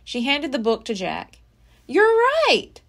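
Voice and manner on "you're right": higher, louder amazed reply; amazed boy. Acted. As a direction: sound struck with amazement. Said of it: "said Jack, amazed".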